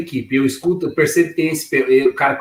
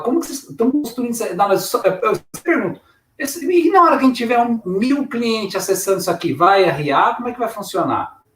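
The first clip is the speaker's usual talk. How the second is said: higher-pitched, animated challenge to the team; challenging questioning; 7 semitones higher than usual. Retold or imitated. Developer questioning the team building fragile software, challenging. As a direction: press them with raised, agitated questions.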